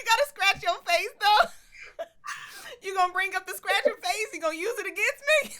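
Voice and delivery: high, laughing through the protest; laughing-crying. Real, not acted. Host teased about scratching her face, laughing. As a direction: laugh till it cracks. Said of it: "I'm crying".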